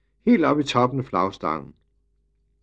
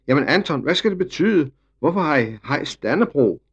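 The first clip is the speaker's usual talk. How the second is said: raised, high-pitched questioning shout; calling out; 6 semitones higher, 5 dB louder than usual. A narrator's reading. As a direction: call over to him, demanding an explanation. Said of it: "fru Petrel råbte til ham".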